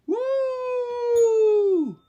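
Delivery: very high, loud cheer; excited whoop. Real, not acted. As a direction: whoop for joy. Finally found a PlayStation.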